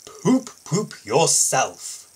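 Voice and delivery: slow, grouchy grumble; a tired big engine grumbling back; cross grumble. Acted. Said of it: "grumbled Gordon crossly".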